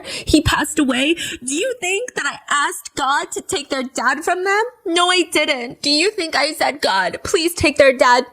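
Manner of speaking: hammed-up tearful streamer imitation; mocking her crying. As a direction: imitate her weepy plea. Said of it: "She's wiping tears from her eyes".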